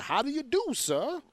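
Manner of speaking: high, overly polite voice; lightly voiced servile politeness; mock minstrel politeness. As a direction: play a grinning eager-to-please act. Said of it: "the juking and the jiving".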